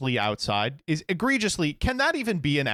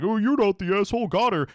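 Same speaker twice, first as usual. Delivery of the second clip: mock commenters; 7 semitones higher than usual. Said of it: "Almost everyone is like".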